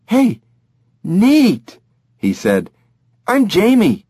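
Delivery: put-on thrilled kid; excited boy.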